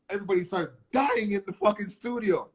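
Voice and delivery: usual speaking voice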